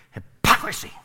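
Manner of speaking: hypocrisy said with open disdain, the middle syllable punched; disdainful. Shown as a demonstration. Preacher teaching the congregation a fun word, disdainful. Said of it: "You gotta say it with some disdain".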